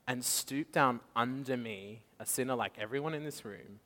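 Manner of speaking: ordinary talk, nothing marked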